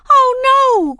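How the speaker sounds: very high, loud horrified wail; alarmed cry. A narrator's reading. Daisy seeing Ramona fall, high panicked cry. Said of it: "cried Daisy".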